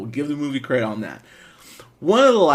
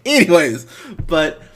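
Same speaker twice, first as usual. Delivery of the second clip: normal talking voice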